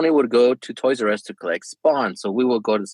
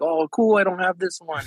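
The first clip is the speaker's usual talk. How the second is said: higher-pitched imitation of an excited child; hyped-up kid; 7 semitones higher than usual. Son surprised by dad with a new card, hyped up. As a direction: lightly act a kid thrilled by a surprise. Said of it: "he gets all hyped up".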